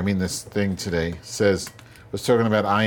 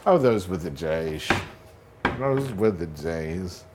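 normal talking voice

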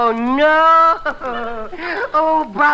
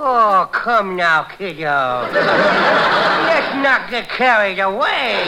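slow laughing drawl; 4 semitones lower, much slower than usual. Acted. Slow, low, laughing reassurance.

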